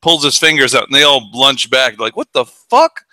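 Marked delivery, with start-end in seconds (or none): 2.2-3.0 startled. Retold or imitated. Thugs startled by Clint.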